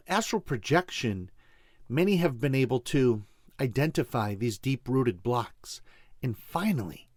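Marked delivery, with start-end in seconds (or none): 6.2-7.1 breathy whispered "finally", falling softly; whispering. Acted. Intimate emphasis in healing talk.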